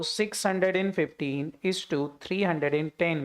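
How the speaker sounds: each number drawn out very slowly; teacher reading out a ratio; slow dictation. Shown as a demonstration. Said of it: "बांट दो जरा इस deficiency को".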